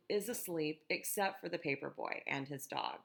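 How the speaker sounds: ordinary delivery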